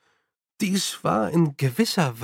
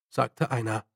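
ordinary delivery, nothing marked

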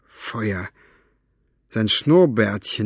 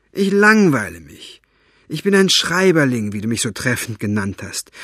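put-on sly persuading old man; 6 semitones higher, 4 dB louder than usual. Fenoglio persuading Buster.

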